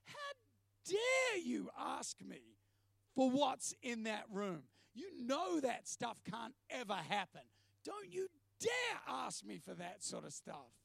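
high, drawn-out indignant challenge; outraged. Retold or imitated. Man retelling his angry answer to God, outraged. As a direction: hit DARE hard, high and stretched. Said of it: "my response was almost like".

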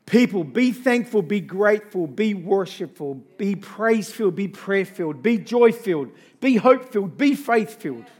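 loud, rising sermon call; rally the congregation, voice ringing; fervent preacher building up the crowd; preaching at full force. Acted. Rousing list to congregation.

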